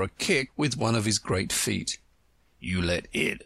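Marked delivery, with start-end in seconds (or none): 2.6-3.4 a hint of panting old frog; panted. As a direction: lower breathless frog. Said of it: "he panted out".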